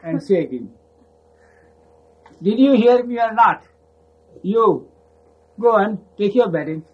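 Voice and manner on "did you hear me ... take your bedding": raised command. Retold or imitated. Baba repeating the order.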